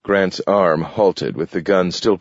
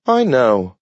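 a lightly higher dog voice; a small dog answering, a bit pitched up; Nathaniel the dog; 6 semitones higher, 4 dB louder than usual. A narrator's reading. Dog Nathaniel assuring Grant, a hint higher. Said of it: "said Nathaniel".

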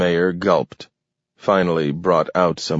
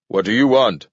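louder yelled reply; yelling back; 4 semitones higher, 3 dB louder than usual. A narrator's reading. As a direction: shout it over distance. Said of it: "Maxwell yelled back".

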